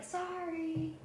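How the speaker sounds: high, small sorry; drawn-out high-pitched apology; sheepish apology. Acted. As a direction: apologize in a little voice. Player apologizes in character.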